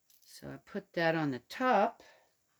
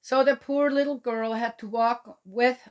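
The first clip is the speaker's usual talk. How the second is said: storybook reading, a touch higher and louder; story narration; 6 semitones higher, 6 dB louder than usual. Acted. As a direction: read the tale to the little ones. Reads the story aloud.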